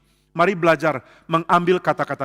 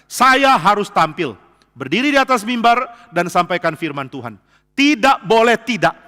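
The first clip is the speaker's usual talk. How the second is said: determined; 5 semitones higher, 7 dB louder, slower than usual. Acted. Spurgeon's resolve to preach.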